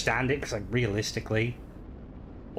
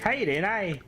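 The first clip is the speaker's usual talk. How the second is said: put-on higher-pitched Northern Irish accent, lightly done; 8 semitones higher than usual. Showing a friend how Northern Irish people sound, in a higher Northern Irish accent. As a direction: give a quick Northern Irish greeting. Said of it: "Northern Irish".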